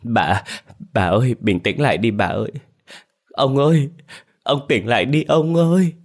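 whimpering plea climbing to a high wail; tearful pleading. A narrator's reading. Maid holding her collapsed mistress, tearful pleading. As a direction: plead through tears, pitch rising on each call. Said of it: "nó ôm lấy bà mếu máo nói".

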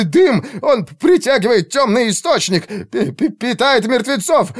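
very high, frantic stammering rant; panicked stuttering. A narrator's reading. Mage feels darkness in his head, hysterical stammer. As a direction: gabble in terror, snagging on consonants. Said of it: "заикался больше обычного".

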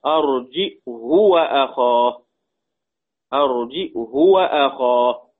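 "أرجئه وأخاه" recited slowly with glottal stop, short "hu"; hamza, short damma. Shown as a demonstration. Teacher showing Abu Amr's reading, "أرجئه وأخاه" as arji'hu, slow. بضم الهاء وقصرها.

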